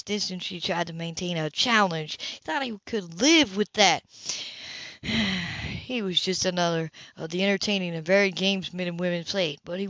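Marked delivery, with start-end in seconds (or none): 4.2-5.8 a long, weary breathy sigh. Acted. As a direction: let the breath go, unsettled. Philip brooding alone.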